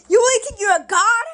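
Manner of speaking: high, loud scoffing question; mocking disbelief. Teen model scoffs at Loki's claim, mocking and loud. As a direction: laugh off the idea that he is a god.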